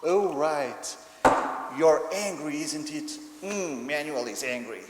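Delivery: loud, playful exaggerated acknowledgement; marked mirroring. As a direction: mirror the child's anger in mild caricature. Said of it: "I need to be marked and contingent first".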